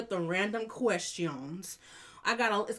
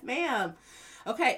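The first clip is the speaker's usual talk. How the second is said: usual speaking voice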